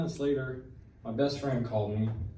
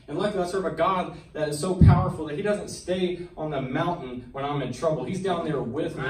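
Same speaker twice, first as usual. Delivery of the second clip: louder, rising preacher's fervor; preaching; 3 semitones higher, 5 dB louder than usual. Acted. To the congregation, preaching his testimony with building fervor.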